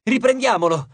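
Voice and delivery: rally the others to give chase; eager shout. A narrator's reading. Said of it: "gridò una ninfa".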